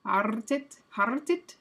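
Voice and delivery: hartet with the R trilled before the t; rolled R attempt. Shown as a demonstration. Streamer checking with chat, trying a rolled R in hartet. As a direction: roll the R in hartet, ending on a questioning rise. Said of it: "hard for me to roll R's".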